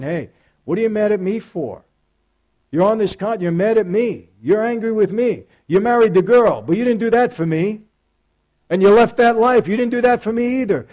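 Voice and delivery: a loving but firm rebuke from the Spirit; voice of the Holy Spirit. Pretend. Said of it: "as if the Holy Spirit was standing in front of me saying".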